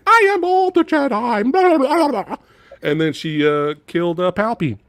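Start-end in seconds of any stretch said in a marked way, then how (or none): 0.1-2.4 high, mocking Jedi proclamation; mock heroic chant; mocking Rey. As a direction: sneer it out in a high sing-song.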